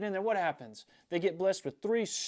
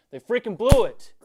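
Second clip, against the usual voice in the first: sudden furious yell, far louder; furious outburst; 4 semitones higher, 14 dB louder than usual. Real, not acted. Fan blowing up over his team choking again, furious yell. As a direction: explode with real frustration on the last words. Rant peaks, then he says sorry.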